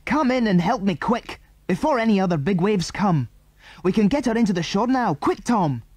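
high, hurried orders; urgent calling. A narrator's reading. Andy urgently calls the others.

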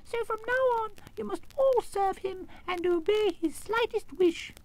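very high squeak, slowing to give orders; tiny queen laying down the law; commanding squeaky queen. A narrator's reading. Mouse queen commanding her subjects, squeaky. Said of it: "in a squeaky little voice".

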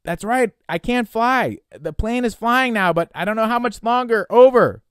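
loud, high, frightened shout; desperate high-pitched transmission; urgent panicked reply. Acted. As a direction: yell the answer into the radio. Frightened boy answering over radio.

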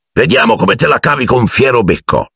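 hearty encouragement. A narrator's reading. Hagrid sets Harry to the hippogriff, encouraging. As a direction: urge him on with enthusiasm. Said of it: "ruggì Hagrid".

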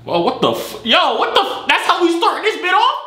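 high-pitched startled disbelief; shocked outcry. Real, not acted. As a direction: pitch shoots up in sudden shock. Startled by a stranger appearing on stream.

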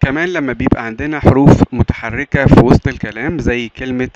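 ordinary delivery

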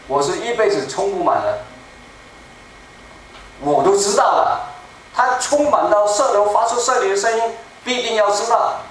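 normal talking voice

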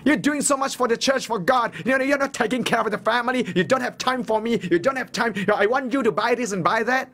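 high, whiny nagging voice; mock nagging wife. Preacher mimics a wife nagging her churchgoing husband, high whine. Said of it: "what would you say?".

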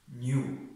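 نیو said with a clear yu sound; teacher stresses the yu in نیو; new with yu. Shown as a demonstration. Teacher shows students the yu sound in نیو.

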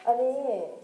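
normal talking voice

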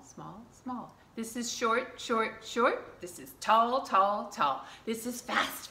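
usual speaking voice

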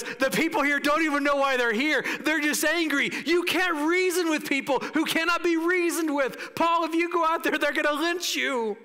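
high, insistent pleading; acted urgent warning. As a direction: hold back a friend from mortal danger. Friend urgently warning Paul of mob.

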